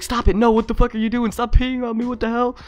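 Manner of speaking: put-on panicked high squeals of a startled kid; scared friend. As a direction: shriek it like a boy who's scared and shocked. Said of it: "He was scared".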